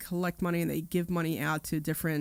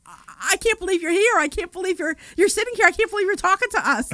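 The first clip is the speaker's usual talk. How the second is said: higher, louder giddy fan disbelief; starstruck disbelief; 12 semitones higher, 6 dB louder than usual. Retold or imitated. Audience fans meeting the radio host, giddy and loud. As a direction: babble like a fan who can't believe it. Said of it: "they're like".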